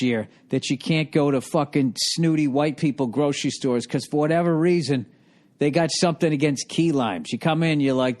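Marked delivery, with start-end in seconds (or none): none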